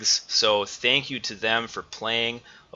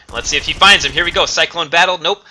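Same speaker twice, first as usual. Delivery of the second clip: higher, louder rising hype; hyped play-by-play; 7 semitones higher, 8 dB louder, faster than usual. Real, not acted. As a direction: build the tension on the call. Tense final duel being called.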